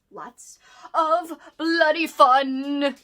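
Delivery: slow, drawn-out gleeful menace; hammed-up horror relish. Selling a slasher book to viewers, slow ghoulish glee. Hamming up slasher book's appeal.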